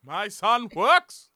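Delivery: slow, proud royal pronouncement; royal movie father. Pretend. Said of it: "theirs is actually more British".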